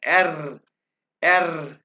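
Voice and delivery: एर said with a trilled tongue R; rolled R. Shown as a demonstration. Teacher shows the class how to roll एर. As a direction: vibrate the tongue on एर. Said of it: "यहाँ पे जुबान को vibrate करना है".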